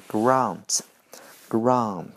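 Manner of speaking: drawn-out British grant; British pronunciation. Shown as a demonstration. Teacher demonstrating British grant, British accent. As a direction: grant with a long British ah, slow. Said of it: "往往会按英式的发音去读".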